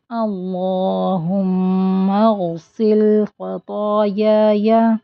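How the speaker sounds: slow melodic Arabic recitation; slow chanted dua; Quran-style recitation. Shown as a demonstration. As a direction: recite slowly with tajweed. Recites full line.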